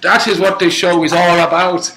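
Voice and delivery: loud, high impression of a TV judge; Louis Walsh. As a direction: proclaim it like a talent show judge. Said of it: "Was it Louis Walsh?".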